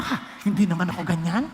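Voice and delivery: low, mocking gossip excusing herself; imitating a churchgoer. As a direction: play the defensive churchgoer with a laugh. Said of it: "isipin nyo".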